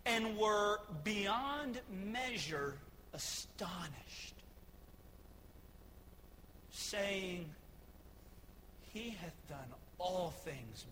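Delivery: scripture reading. Real, not acted.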